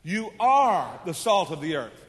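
slow and loud, leaning on "are"; slow, loud reading that hits "are"; stress on ARE. Shown as a demonstration. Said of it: "Let's read it like that".

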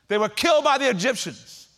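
loud, high, building sermon fervor; father telling his son of slavery, loud and fervent; impassioned preaching. Acted. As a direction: preach it like the testimony of a lifetime. Father preaches with rising force.

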